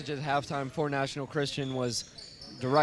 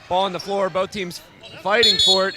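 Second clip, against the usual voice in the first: rising, louder play-by-play; excited call; 4 semitones higher, 9 dB louder than usual. Real, not acted. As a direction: call the scramble with urgency. Scramble for a loose ball.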